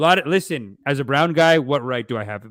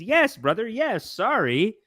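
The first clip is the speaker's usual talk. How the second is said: mock brotherly agreement; 8 semitones higher, slower than usual.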